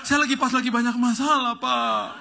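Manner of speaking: overwhelmed driver gushing and half-sobbing; hammed-up crying driver. Driver weeping to the preacher he just recognized, mock-sobbing. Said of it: "Dia nangis tiba-tiba".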